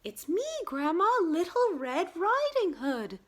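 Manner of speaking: put-on high, loud little-girl voice. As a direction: pitch it up as an eager young heroine.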